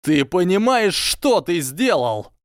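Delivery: furious yell at Harry in an empty classroom; shout. A narrator's reading. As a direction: scream it at him in fury. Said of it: "Его голос перешёл на крик".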